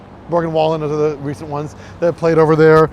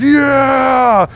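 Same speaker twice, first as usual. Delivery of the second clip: imitating a crowd's loud cheer, higher; crowd yell; 9 semitones higher, 8 dB louder than usual. Imitating the stadium crowd, a loud cheering yell. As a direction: shout it like a whole stadium roaring. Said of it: "and everyone goes".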